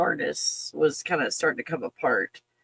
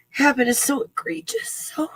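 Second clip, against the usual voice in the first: voice breaking; 8 semitones higher than usual. Real, not acted. Emotional outrage, breaking.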